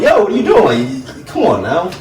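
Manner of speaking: sudden high loud yelp of protest; startled protest. Retold or imitated. Man to girlfriend who stepped on his foot, startled yelp. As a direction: jump up in pitch and volume like your foot just got stepped on.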